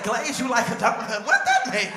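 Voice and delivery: glaze you like a donut, then a sudden high squeak on what; baffled question. Retold or imitated. Family repeats the auntie's odd phrase, baffled and squealing. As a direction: disbelief jumping up into a squeal.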